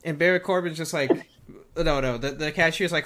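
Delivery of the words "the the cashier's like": usual speaking voice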